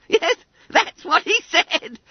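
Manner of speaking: very high, loud schoolboy squeal; gleeful trill. A narrator's reading. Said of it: "trilled Bunter".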